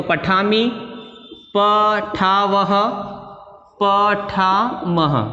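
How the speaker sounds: each form drawn out very slowly; slow recitation. Shown as a demonstration. Teacher slowly spelling out forms for pupils, drawn out. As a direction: stretch every syllable so pupils can copy it. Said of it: "ये helping verb ऐसे कैसे लेके आ जाएंगे".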